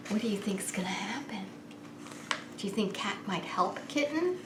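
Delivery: whispered, quick question to the listeners; hushed suspense. A narrator's reading. Reading to children, whispering to little listeners in suspense. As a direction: draw the children in with a secret hush.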